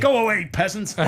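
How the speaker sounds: high, loud toffee-nosed dismissal; mock posh snob. Teasing Tom about his Tory twang, mock posh sneer. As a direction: play a haughty aristocrat shooing a peasant.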